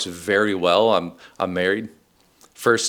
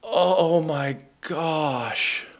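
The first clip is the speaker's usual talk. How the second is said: very slow, drawn-out "Oh my gosh"; awed, stretched realization; dawning realization; 7 semitones higher, 3 dB quieter, much slower than usual. Retold or imitated. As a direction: let it sink in word by word. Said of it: "And I was like".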